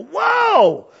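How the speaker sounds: high, loud startled whoa; loud shocked exclamation. Retold or imitated. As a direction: jump back in mock alarm. Reacting to screamer.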